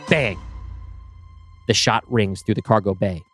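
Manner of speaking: usual speaking voice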